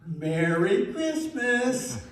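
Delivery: put-on high, slow parrot echo of the girl; parrot in Jean's voice. Parrot finally repeating the girl's Christmas words. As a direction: answer like the bird copying her. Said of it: "right in Jean's voice".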